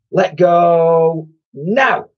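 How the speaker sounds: high, loud, slow command; drawn-out boy's command. Acted. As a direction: order the monster to let go. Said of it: "commanded Ned".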